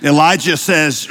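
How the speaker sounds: ordinary delivery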